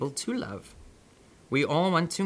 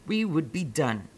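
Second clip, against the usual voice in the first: usual speaking voice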